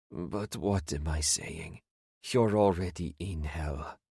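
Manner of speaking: resigned whisper. A narrator's reading. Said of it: "a resigned whisper".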